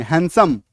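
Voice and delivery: हैंडसम as हैन-सम, silent D; correct pronunciation. Shown as a demonstration. Teacher gives class the right word, correct pronunciation. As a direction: leave the ड out of हैंडसम. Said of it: "नहीं बोलेंगे, हैंडसम।".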